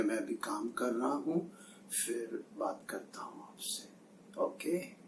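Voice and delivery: put-on soft, hushed phone murmur to a child; sudden softened tone. Boss taking a call from his child mid-rant, suddenly soft. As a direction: drop to a gentle near-whisper, as if on the phone. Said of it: "sudden change in the tone of his voice".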